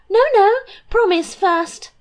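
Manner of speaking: high, pleading no no; insistent princess. Acted. Princess begging the king to promise, high and eager. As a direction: beg him to promise first.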